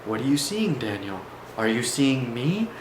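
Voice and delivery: understated eerie calm. Retold or imitated. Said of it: "the voice of Mister L goes".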